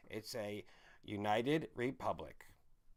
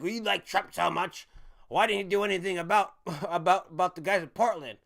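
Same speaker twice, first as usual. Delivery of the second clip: loud, higher mock yell of an angry dad; retold yelling dad; 5 semitones higher, 8 dB louder than usual. Son retells dad yelling at him, loud and higher. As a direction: shout it like a fed-up father. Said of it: "He was yelling at me one day".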